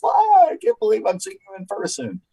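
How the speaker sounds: high, gushing happy exclamation; playfully imitated joyful reunion; delighted disbelief. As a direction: gush as if seeing a friend after years. Mimics delight at seeing colleagues.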